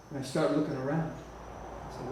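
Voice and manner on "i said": ordinary talk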